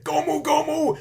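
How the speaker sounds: very high, loud battle call; attack shout. Retold or imitated. Luffy jumping up to attack, high loud battle shout. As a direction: yell the move name like an anime hero.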